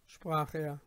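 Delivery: plain reading voice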